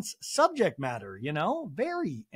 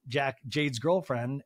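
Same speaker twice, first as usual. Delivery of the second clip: normal talking voice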